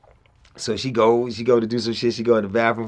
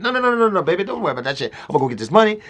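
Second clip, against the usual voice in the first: fast talking; 8 semitones higher, 3 dB louder, much faster than usual. Retold or imitated. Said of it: "my Eddie Murphy fast talking shit".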